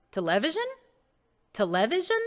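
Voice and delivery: television as a doubtful te-LE-vision?; questioning wrong stress. Shown as a demonstration. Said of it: "and not television".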